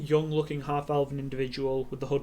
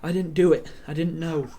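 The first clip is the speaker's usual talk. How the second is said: downcast denial; 3 semitones higher, 5 dB louder than usual. Acted. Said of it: "looks at the ground and goes".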